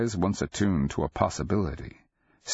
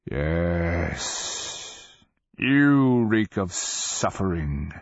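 slow dragon voice; much slower than usual. A narrator's reading.

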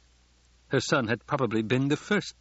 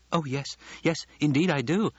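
lightly voiced young girl, higher; girl putting on an act; 6 semitones higher than usual. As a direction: play a hint of empty-headed eagerness. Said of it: "near perfection of the girl's act".

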